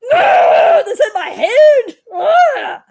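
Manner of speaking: high laughing yell of frustration; frustrated shout. Acted. Astronaut yelling at the phrase stuck in his head, laughing shout. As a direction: scream it out like the words won't leave your head.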